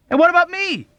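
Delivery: high, loud whiny demand; petulant. A narrator's reading. Patient demanding petulantly of the doctors. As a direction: stress what, sulky child. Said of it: "demanded petulantly".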